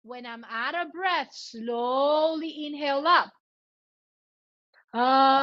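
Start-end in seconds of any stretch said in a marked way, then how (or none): 4.9-5.4 long, even ah on one note; sustained "ah". Shown as a demonstration. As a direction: voice exercise ah held steadily. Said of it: "I'm gonna go, ah".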